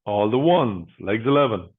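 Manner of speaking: steady, rhythmic number calling; bingo caller. Acted. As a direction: call each number out, then pause.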